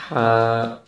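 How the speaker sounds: ہا breathed from the middle of the throat; Arabic letter Ha. Shown as a demonstration. Teacher demonstrates Arabic ہا to students. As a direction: say ہا with a breathy throat h, not from the chest. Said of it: "تو اس طرح اس کو پڑھیں گے".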